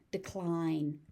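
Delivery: usual speaking voice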